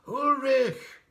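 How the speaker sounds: a high, loud call from far off; distant cry. A narrator's reading. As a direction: call his name across the mountain. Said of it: "somebody had cried out".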